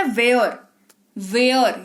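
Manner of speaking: वेयर with an open ae vowel; where with ae. Shown as a demonstration. Teacher demonstrating the ae vowel in where. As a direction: say वेयर with the ae sound. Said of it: "ए का sound निकल रहा है".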